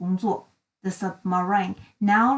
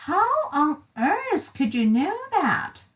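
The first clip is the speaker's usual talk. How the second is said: surprised question; 5 semitones higher than usual. Acted. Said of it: "asked little Miss Sunshine".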